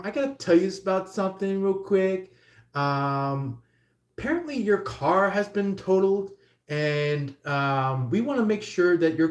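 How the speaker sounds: hammed-up call-centre patter; mock insurance agent. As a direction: act a pushy phone rep rattling on. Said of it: "somebody going like".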